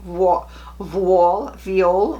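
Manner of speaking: "voile" drawn out slowly, then "viole"; hesitant, slow attempts at "voile" and "viole"; unsure pronunciation. Shown as a demonstration. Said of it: "How do you say V O I L E?".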